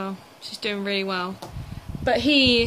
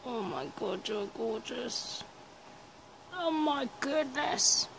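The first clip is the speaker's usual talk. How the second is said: soft cooing; 6 dB quieter, slower than usual. Real, not acted. Cooing softly at pet.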